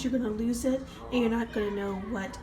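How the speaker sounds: normal talking voice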